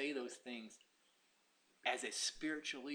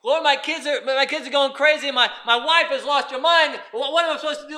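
pleading complaint; 12 semitones higher, 20 dB louder than usual. Acted. Believer complaining to God.

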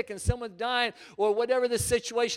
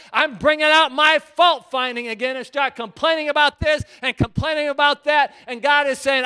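loud, mounting pulpit rebuke; fired-up preaching; 3 semitones higher, 11 dB louder than usual. Acted. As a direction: act out the hypocrite while preaching hard. Preaches acting out hypocrite, then God.

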